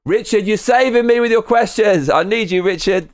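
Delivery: high, loud hammed-up pleading; mock desperate plea. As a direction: beg Richard like he's your lifeline.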